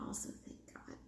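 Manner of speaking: unvoiced muttered aside; whispering. Real, not acted. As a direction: breathe it out under your breath. Relieved aside, under breath.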